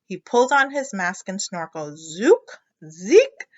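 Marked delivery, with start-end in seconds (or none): none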